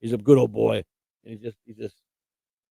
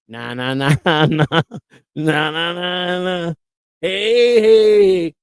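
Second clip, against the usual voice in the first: taunting; 3 semitones higher, 4 dB louder, much slower than usual. Pretend. Mocking taunt chant.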